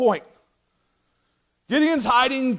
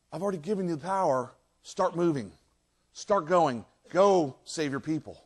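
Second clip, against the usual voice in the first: soft, lowered voice of God; quiet urging; 4 semitones lower, 6 dB quieter than usual. Acted. God already telling you to go, quietly urging. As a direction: urge them on softly, low.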